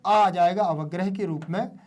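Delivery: ordinary delivery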